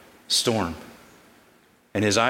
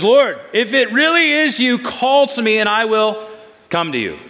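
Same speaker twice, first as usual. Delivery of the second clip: much higher and louder, eager Peter calling out; Peter pleading; 10 semitones higher, 5 dB louder than usual. Acted. Peter calls to Jesus on the water, eager and high. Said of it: "He says".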